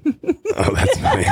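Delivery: talking through real laughter, pitched high; laughing. Real, not acted. Host reacts to co-host's avocado joke, laughing. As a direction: crack up while getting the words out.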